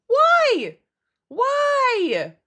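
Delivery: high, drawn-out wail of "why"; exasperated wail. Retold or imitated. Vegan streamer lamenting honey in bread, exasperated. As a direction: cry it out in exaggerated disbelief.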